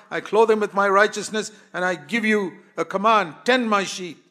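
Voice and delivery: high, loud commanding sermon voice; fervent preaching; preaching at full force. Acted.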